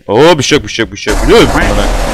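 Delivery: loud, jumpy alarm; frightened outburst. Real, not acted. Player spotted by the monster, loud frightened outburst. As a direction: blurt it as the monster closes in.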